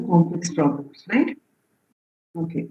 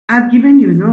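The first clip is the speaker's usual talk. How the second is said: louder and higher, cutting in; loud interjection; 3 semitones higher, 6 dB louder than usual. Real, not acted. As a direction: break in over the class. Teacher cuts in on students.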